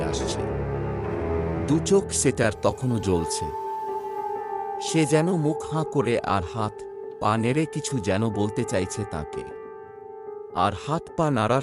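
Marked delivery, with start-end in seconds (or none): none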